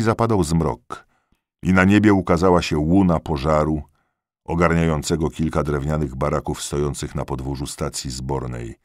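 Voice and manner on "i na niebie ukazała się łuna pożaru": ordinary delivery, nothing marked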